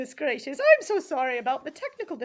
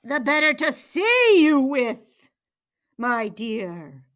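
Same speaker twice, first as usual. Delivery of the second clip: loud storybook wolf, falling at the end; wolf as granny; 10 dB louder, slower than usual. A narrator's reading. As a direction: relish it loudly as the disguised wolf. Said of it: "said the wolf".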